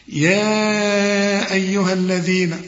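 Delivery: slow chanted tajweed recitation; Quran recitation. Shown as a demonstration. Teacher recites verse. (تلاوة مرتلة بطيئة)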